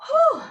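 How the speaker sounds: breathy high puff of relief; relieved whew. Real, not acted. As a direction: blow out after the effort. End of a hard set.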